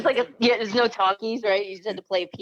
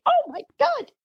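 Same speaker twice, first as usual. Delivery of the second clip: very high mock-shocked squeal; high-pitched, giggly disbelief; retold shock. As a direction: replay a scandalized teen's reaction. Retelling shocked reaction to centerfold.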